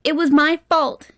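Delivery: anguished loud confession; exclaiming. A narrator's reading. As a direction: blurt out the guilt. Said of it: "he suddenly exclaimed".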